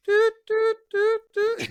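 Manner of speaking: very high, loud doot doot beeping; robot beeps. Retold or imitated. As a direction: beep like a little cleaning robot. Imitating the robot cleaner's beeps.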